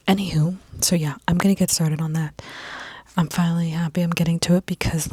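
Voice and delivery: ordinary talk, nothing marked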